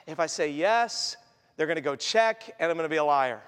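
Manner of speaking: low voice after a heavy sigh; resigned. Acted. Child trapped by parent's question, low and resigned. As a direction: reason it out glumly, sunk low.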